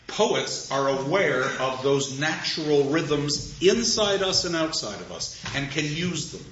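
ordinary talk, nothing marked

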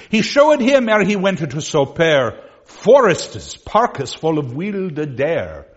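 put-on loud, rounded old vowels read aloud; Middle English reading. Shown as a demonstration. Teacher reading Chaucer's lines to the class in Middle English. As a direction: recite the old text like Chaucer. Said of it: "Let me continue".

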